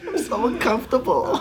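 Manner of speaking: high, hammed-up whine; mock whimper. Recruit on the inside feels gross, high mock whimper. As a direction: play the miserable fussy recruit. Acting out squeamish recruit in bed.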